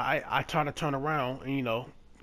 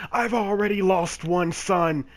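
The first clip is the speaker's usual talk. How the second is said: slow, louder, higher hammed-up lament; melodramatic man; 7 semitones higher, 8 dB louder, slower than usual. Mocking the stranger's dramatic claim, slow loud hammed-up lament. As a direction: ham it up like a soap-opera plea.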